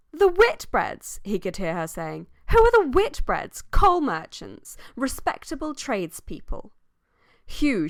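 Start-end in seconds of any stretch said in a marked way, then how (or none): 0.1-1.2 high, loud, drawn-out exclamation; scornful outcry. Acted. Sally scoffing at the Whitbread family, scornful outcry. 2.5-6.7 loud, high-pitched haughty disdain; scornful dismissal. Acted. Sally dismissing a family as mere tradespeople, scornful.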